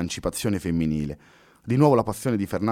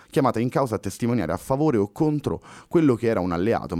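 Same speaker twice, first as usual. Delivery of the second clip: usual speaking voice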